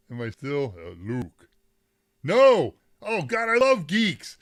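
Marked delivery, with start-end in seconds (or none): 2.2-4.4 loud outburst. Real, not acted. Real outburst at a geek trivia chat.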